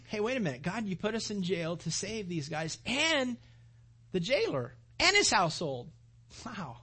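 normal talking voice

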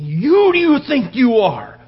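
loud, slow, high furious shout; a mob roaring at Stephen; angry mob yell. Retold or imitated. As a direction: bellow it like an enraged crowd.

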